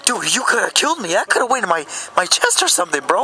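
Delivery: high-pitched shocked protest at a sibling; high, aghast telling-off; indignant outburst. Retold or imitated. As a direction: retell it as outraged disbelief, pitched well up. Shocked scolding of his brother retold.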